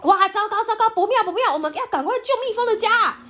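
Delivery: high, fast panicked cry; urgent child voice. Acted. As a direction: hurry everyone along, alarmed. Urgent rescue of bee home.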